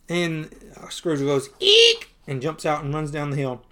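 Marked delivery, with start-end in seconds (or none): 1.6-2.1 loud, piercing comic eek; short very high squeal of fright; frightened shriek. Acted. Scrooge fleeing the bear, sudden high shriek.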